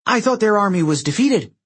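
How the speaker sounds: high-pitched, startled protest; alarmed disbelief. A narrator's reading. Demigod thinking defeated giants are back, high alarmed disbelief. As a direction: question it in rising alarm. Annabeth alarmed under attack.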